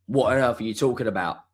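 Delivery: ordinary delivery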